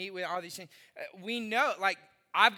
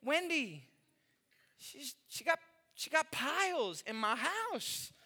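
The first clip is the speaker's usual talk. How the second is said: ordinary talk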